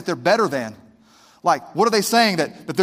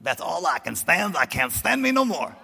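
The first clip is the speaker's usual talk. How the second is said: lightly gravelly Popeye quote, a hint of the cartoon. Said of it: "what he said".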